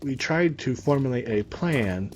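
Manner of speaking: ordinary delivery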